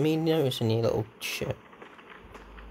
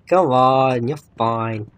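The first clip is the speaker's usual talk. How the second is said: ordinary talk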